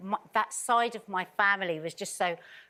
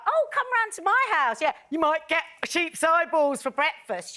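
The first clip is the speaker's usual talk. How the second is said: much higher, lively invitation; eager young self; 8 semitones higher than usual. Retold or imitated. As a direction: invite a friend home proudly. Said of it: "I was like".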